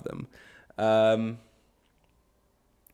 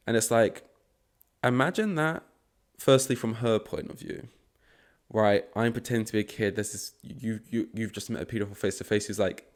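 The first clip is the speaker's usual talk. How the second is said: ordinary delivery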